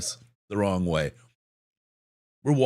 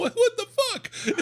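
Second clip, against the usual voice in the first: laughing. Retold or imitated. Wife coming back.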